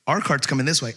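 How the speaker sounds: "Our" punched, falling tune after it; stress on our. Retold or imitated. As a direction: hit "Our" as the penny drops. Realizing it is their cart.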